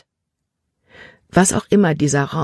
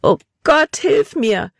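desperate cry; 9 semitones higher, slower than usual. Acted. Libby, alone in the dark, crying out desperately to God. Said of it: "rief sie verzweifelt".

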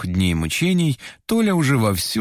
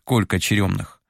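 ordinary delivery, nothing marked

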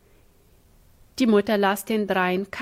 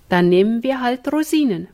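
usual speaking voice

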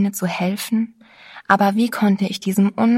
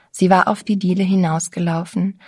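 ordinary delivery, nothing marked

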